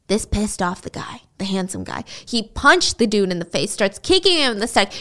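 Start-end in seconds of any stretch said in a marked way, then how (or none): none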